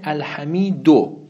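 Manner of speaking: «الحمیدو» said slowly, leaning on the final u; final u stressed. Shown as a demonstration. Teacher showing students the nominative ending in «الحمیدو», slow and pointed. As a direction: draw out «الحمیدو» and land on the closing u. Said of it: "اما الان چی اومده؟".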